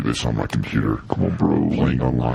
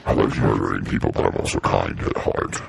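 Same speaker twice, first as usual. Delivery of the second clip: low processed villain voice; a deep gruff villain filter; 7 semitones lower than usual. Pretend. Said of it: "I should have been Frank".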